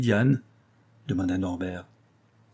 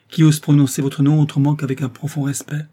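higher, louder outraged challenge; enraged exclamation; 5 semitones higher, 6 dB louder than usual. A narrator's reading. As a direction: demand it with indignant anger. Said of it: "était transporté de colère". (indignation pleine de colère)

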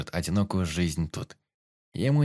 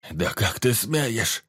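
indignant; much slower than usual. Acted. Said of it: "возмутился Араос".

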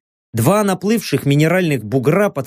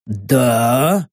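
surprised; 5 semitones lower than usual. A narrator's reading. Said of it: "нескрываемо изумлённый мужской голос".

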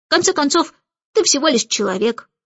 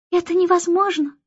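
crying out; 6 semitones higher than usual. A narrator's reading. Newborn refusing to believe the Starling, crying out. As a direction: protest in a raised little voice. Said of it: "кричала Аннабелла".

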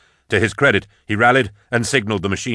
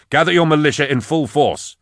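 raised, commanding call; shouted order; 6 semitones higher than usual. A narrator's reading. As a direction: shout the order across the street. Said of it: "I shouted".

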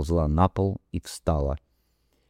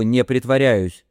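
moaning; 5 semitones higher, 5 dB louder than usual. Acted. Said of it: "Последние слова перешли в стон".

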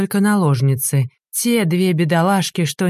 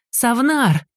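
screeching; 6 semitones higher than usual. A narrator's reading. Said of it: "заверещала Лахджа".

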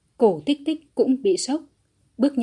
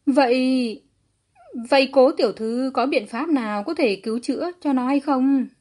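higher, trembling anxious plea; 4 semitones higher, 4 dB louder than usual. A narrator's reading. Desperate father asking whether his son can be saved, trembling plea. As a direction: stammer out a shaky, hopeful question. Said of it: "giọng nói càng trở nên run rẩy".

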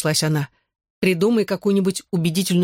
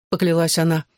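ordinary delivery

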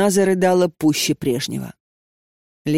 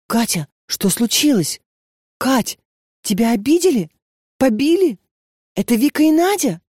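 higher, anxious rushed questions; alarmed worry; 6 semitones higher than usual. A narrator's reading. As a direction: panic over a crying friend. Lenochka finds Katya crying.

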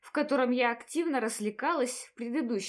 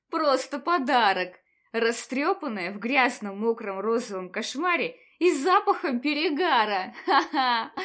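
laughing through the words; laughing hysterically; 4 dB louder than usual. Acted. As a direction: hysterical giggling fit. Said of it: "я безудержно расхохоталась". (истерический смех сквозь слова)

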